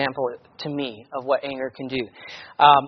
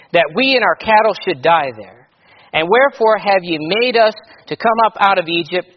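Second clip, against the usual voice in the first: loud, measured King James reading voice; scripture reading; 6 dB louder than usual. Acted. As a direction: read the Bible passage out to the church with weight. Preacher reads scripture aloud.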